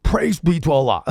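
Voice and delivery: loud politician's sign-off; Bernie Sanders. Acted. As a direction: belt it out as the old senator. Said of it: "Bernie, real quick, one last sentence".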